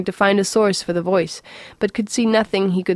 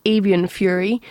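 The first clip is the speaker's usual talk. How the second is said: usual speaking voice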